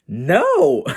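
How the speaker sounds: high, loud mock-outraged no; emphatic refusal. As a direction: burst out with a high, punchy refusal. Emphatic answer to dad's nagging.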